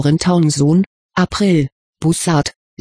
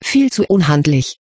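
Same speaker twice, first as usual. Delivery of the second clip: usual speaking voice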